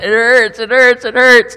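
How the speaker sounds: high, loud pained cry of it hurts; retold pained cry. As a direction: cry out in pain as if back in the ER. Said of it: "and I said".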